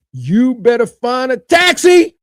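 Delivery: normal talking voice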